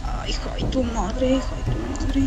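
breathless whispered curse; frightened whisper while hiding. Real, not acted. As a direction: hush it so nothing hears you.

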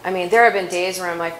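ordinary talk, nothing marked

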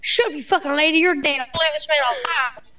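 ordinary talk, nothing marked

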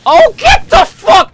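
very high, loud screech; real rage outburst, voice shooting way up; furious yell. Real, not acted. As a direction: scream it at the game. Hit in the game, yells.

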